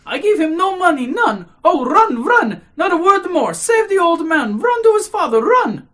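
high, loud, urgent exclamations; frantic cry. A narrator's reading. Agitated lady sending the official to save the old man, frantic. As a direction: urge him to run, in a panic. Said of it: "she cried out".

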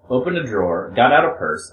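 ordinary delivery, nothing marked

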